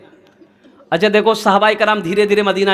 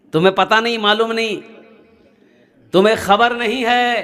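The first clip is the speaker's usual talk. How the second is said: usual speaking voice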